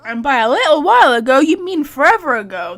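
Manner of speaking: loud, quick retort stressing "forever"; loud sarcastic jab. Real, not acted. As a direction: scoff loudly at the timeline, punching "forever". Mocking the game's timeline.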